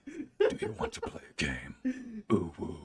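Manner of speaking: put-on menacing puppet-master turned anime girl; Jigsaw. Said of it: "Jigsaw becoming an anime girl".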